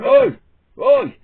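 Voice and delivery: loud, high "Oi!" across the street; loud high street shout; shouted call. Retold or imitated. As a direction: call out sharply to stop them. Blokes calling out on street.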